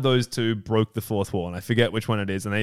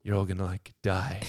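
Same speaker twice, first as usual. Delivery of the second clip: much softer, lower delivery; deadpan doom; 4 semitones lower, 9 dB quieter than usual. Acted. Welcoming host turning on the guests, quiet deadpan threat. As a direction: drop it low and quiet.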